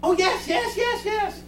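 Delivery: very high, soft squeal of yeses; mock a thrilled bride saying yes; rapid-fire yeses in a tiny high voice; imitated excited acceptance. Wife suddenly realises and accepts.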